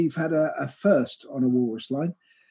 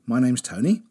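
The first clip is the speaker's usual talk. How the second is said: radio presenter; 3 semitones lower than usual. Acted. Podcast host intro.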